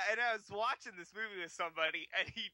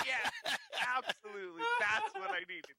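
sarcastic; 12 semitones higher than usual. Retold or imitated. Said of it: "I'm like".

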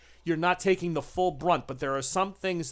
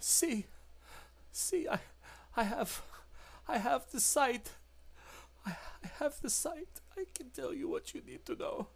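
high creature whimper; 9 semitones higher, 11 dB quieter than usual. Acted. The creature fearfully whimpers about Arturo, very high and soft. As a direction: squeak out a frightened apology.

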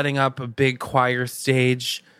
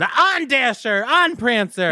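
high, drawn-out sleigh driver's cry; hammed-up Santa-style call to the team; jokingly calling out; 11 semitones higher, slower than usual. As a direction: call the reindeer on with mock grandeur. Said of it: "when you jokingly said".

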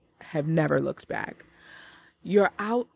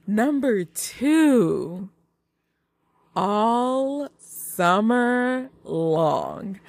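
slow, stretched, higher title reveal; announcing; 4 semitones higher, much slower than usual. Shown as a demonstration. Host revealing second movie pick, announcing. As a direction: draw every word out like a countdown reveal.

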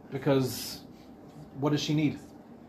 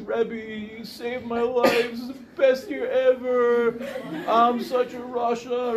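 put-on higher, slow, laughing sloppy drunk voice; drunk boy gushing; 5 semitones higher, slower than usual. Drunk student gushing love at his rebbe, slow and giggly. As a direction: gush like a tipsy student hugging his teacher.

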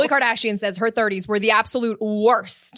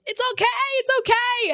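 much higher, breezy mock reassurance; imitating the chef. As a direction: brush off the fire like it's nothing. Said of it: "So the guy goes".

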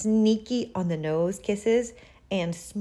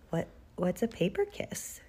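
put-on softer, hesitant child's question; puzzled question; 7 dB quieter than usual. A narrator's reading. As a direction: ask quietly, unsure what it means. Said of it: "asked Bernard".

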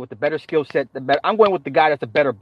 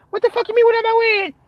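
mock indignant partner, pitched up; outraged girlfriend. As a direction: voice her shrieking back in disbelief.

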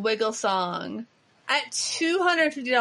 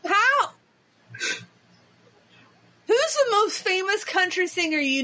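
high-pitched exasperated outcry; mock exasperation; 7 semitones higher than usual. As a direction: cry out in comic despair. Mock outrage at cohost's ignorance.

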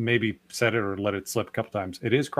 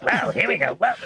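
Popeye voice; 4 semitones higher, 3 dB louder than usual. Shown as a demonstration. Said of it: "It's my high pitch, uh, Popeye voice".

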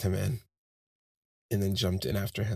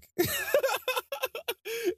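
high-pitched giggling burst; helpless, high laugh. Real, not acted. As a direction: let out a high run of laughter. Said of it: "And I started to laugh".

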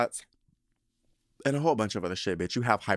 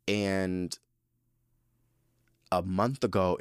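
ordinary talk, nothing marked

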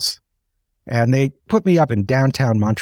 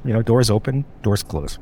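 ordinary delivery

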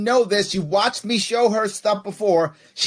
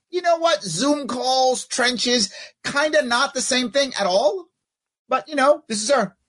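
exasperated; 7 semitones higher than usual. Real, not acted. Exasperated sarcastic rant.

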